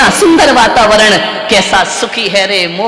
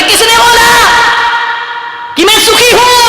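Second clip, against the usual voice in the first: indignant swan; 11 semitones higher than usual. Acted. Swan denies being happy.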